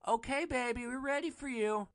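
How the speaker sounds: high, put-on coaxing call; calling out sweetly. Hooker calling the driver back, high coaxing voice. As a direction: call him back to the car. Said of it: "she calls to me".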